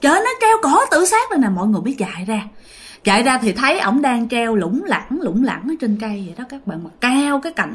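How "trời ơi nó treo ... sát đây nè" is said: much higher-pitched imitation of grandma crying out; retold cry of alarm. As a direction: cry out as the grandma who has found him. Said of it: "bắt đầu bà ngoại mới la lên". (giả giọng bà ngoại la lên)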